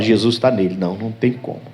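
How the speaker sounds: low, quiet retreating demon; soft, low-pitched giving up; defeated devil. Acted. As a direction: back away as a beaten devil, hushed. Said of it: "ele fala".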